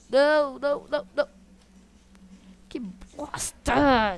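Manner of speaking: loud, dragged-out frustration; frustrated shout. Real, not acted. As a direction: yell it, annoyed. Taking heavy damage.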